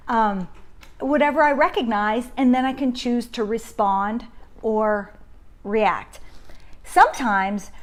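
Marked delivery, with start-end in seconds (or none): none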